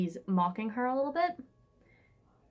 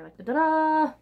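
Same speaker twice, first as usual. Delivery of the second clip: scampering noise; 8 semitones higher, 7 dB louder than usual. Retold or imitated. Imitates vampire scampering off.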